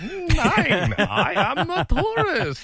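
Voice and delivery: slow, high bad German accent. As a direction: ham up a German tourist accent. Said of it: "a bad German accent".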